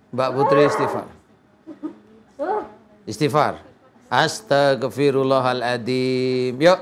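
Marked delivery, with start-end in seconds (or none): 4.1-6.6 drawn-out phrase to be followed; slow recitation. Shown as a demonstration. Healer leading Mbak Putri in istighfar, slow recitation. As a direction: lead the prayer slowly, syllable by syllable.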